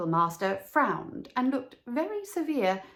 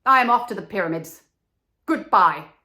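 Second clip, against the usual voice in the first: loud and fast parting shot; angry farewell; 10 dB louder, faster than usual. Acted. Swallow leaving the reed, loud fast goodbye. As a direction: storm off loudly. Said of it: "he cried".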